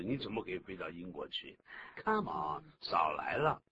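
usual speaking voice

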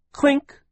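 very high, loud ringing clink; clink sound. Acted. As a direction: mimic the metal strike. Sound effect of sword clash.

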